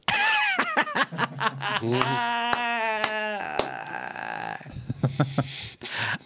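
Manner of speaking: long burst of laughter trailing into a held note. Real, not acted. Host cracking up at Johnny's reply, long laugh.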